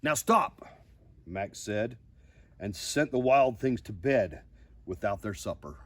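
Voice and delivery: lively storybook reading, loud high peaks; reading to children. Acted. Host reading a picture book to viewers, expressive storybook voice. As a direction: read the ending with big drama.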